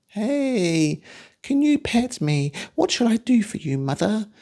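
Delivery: high, sweet, eager little creature; cute pet alien voice. Retold or imitated. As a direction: coo like a tame alien pet. Mocks the tamed pet alien.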